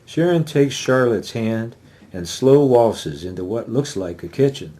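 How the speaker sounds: ordinary talk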